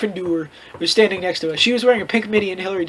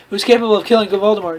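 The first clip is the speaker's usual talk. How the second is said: usual speaking voice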